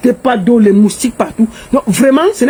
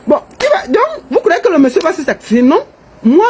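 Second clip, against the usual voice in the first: ordinary talk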